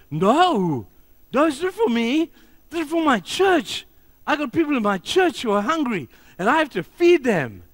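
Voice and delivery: loud, high-pitched, earnest Russian pastor imitation; amateur Russian accent, voice soaring and insistent; attempted Russian accent. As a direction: protest passionately in a rough Russian accent. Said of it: "in his Russian accent, which I don't know how to do".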